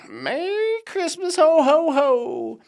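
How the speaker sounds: high, loud, drawn-out mock Santa holler; jolly Santa call. Vlogger hamming up a card's Christmas greeting, jolly Santa call. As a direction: sing out the greeting, booming the ho-ho-hos.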